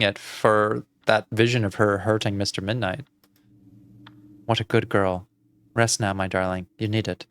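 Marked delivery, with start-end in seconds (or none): 4.5-7.3 low, soft lullaby-like reassurance; hushed, lower motherly voice; soothing aunt. Acted. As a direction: settle the child down to rest.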